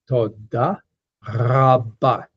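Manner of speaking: toda rabba in separated words, long uvular trill on the R of rabba; deliberate, fully trilled toda rabba; very careful pronunciation. Shown as a demonstration. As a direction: pronounce toda rabba painstakingly, rolling the R at the back of the throat. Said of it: "very carefully pronounced as".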